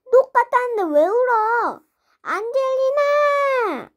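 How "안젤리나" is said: high, slow, drawn-out sobbing name; crying name. Acted. Crying girl wailing her own name. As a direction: stretch the name out in a wail. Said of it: "오빠 때문에 안젤리나 울잖아".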